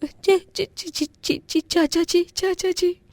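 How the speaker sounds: very high, stuttering in fear; stammer, too scared to speak; frightened stammer. Acted. Terrified niece stammering to her uncle, high and fearful.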